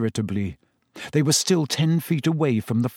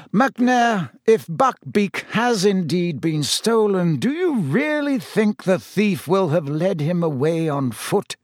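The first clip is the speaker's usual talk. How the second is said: higher, leisurely amused reasoning; 6 semitones higher, slower than usual. A narrator's reading. Headmaster talks the executioner out of searching, amused. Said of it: "still sounding amused".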